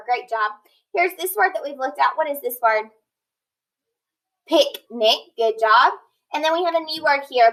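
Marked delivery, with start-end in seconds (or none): none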